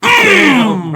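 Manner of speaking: shouted "bam" sound effect; imitated impact. Retelling how he lifts him up, explosive imitated bam. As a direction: burst it out like a hit.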